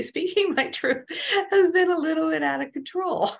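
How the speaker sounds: laughing through the words, pitched high; amused confession, laughing. Real, not acted. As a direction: giggle as you admit it. Laughing about her own outbursts.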